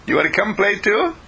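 a playful pup greeting his master; bouncy, eager puppy hello; happy dog voice. Acted. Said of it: "and he says".

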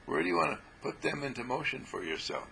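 usual speaking voice

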